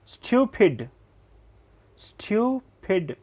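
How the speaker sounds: usual speaking voice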